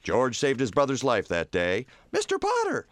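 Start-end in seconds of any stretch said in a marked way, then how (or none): 2.1-2.9 put-on very high boyish call; very high child calling a name; young boy voice. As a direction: pipe it up like a little boy. Child voice in the story.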